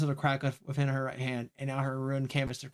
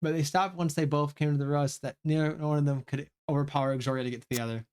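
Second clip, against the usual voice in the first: ordinary talk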